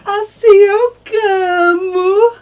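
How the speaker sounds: high, loud theatrical wail; hammed-up sobbing cry; mock wailing. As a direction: wail it out like a melodramatic cockroach. Baratinha cries out in story.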